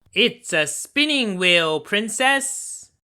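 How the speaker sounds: high, slow crone voice; old woman disguise. A narrator's reading. The disguised wicked fairy answers the princess, high and slow. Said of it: "answered the old woman".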